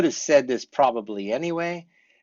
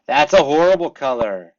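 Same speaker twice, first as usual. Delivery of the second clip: loud disgust; 2 semitones higher, 10 dB louder than usual. Shown as a demonstration. Demo of reacting to a color.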